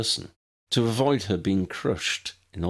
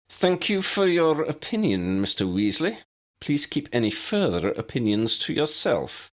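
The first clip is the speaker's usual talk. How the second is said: crisp, higher scolding of a pupil; stern scolding; 7 semitones higher than usual. A narrator's reading. McGonagall scolding Ron for his cheeky aside. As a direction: put the boy firmly in his place. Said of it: "McGonagall scolded".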